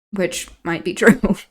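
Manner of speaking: plain reading voice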